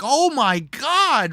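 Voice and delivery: high, slow mock exclamation; drawn-out, high-pitched retold groan of words; retold exasperated reaction. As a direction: stretch it out in mock dismay. Retelling his reaction to the outburst.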